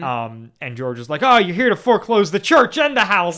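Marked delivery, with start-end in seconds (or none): 1.2-3.4 much higher, louder, giddy; manic joy. Acted. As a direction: gush like a man overjoyed at ruin.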